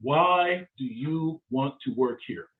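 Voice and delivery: very slow, each word set apart; slow, deliberate question. Shown as a demonstration. Interview coach posing the key question to job seekers, slow and deliberate.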